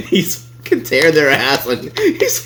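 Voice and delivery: talking through real laughter; laughing. Real, not acted. Host tickled by DoorDash getting torn apart, laughing. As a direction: crack up while getting the words out. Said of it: "It's funny".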